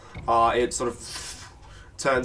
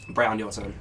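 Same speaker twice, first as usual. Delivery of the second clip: usual speaking voice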